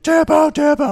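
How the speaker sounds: very high, loud mock yell; hammed-up shouting; yelling tap out. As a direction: shout it like men around a fight. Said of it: "They're like".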